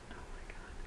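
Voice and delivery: breathless, stunned whisper; a real hushed oh my God; awed whisper. Real, not acted. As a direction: whisper it like you can't believe it. Overwhelmed by a handmade gift.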